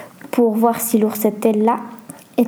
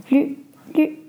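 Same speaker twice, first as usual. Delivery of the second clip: higher, halting and trembling with fear; fearful stammer; 5 semitones higher, slower than usual. A narrator's reading. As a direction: stammer it in mild acted fright, with gaps between words. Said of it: "murmura Julia en tremblant de peur". (bégaiement apeuré)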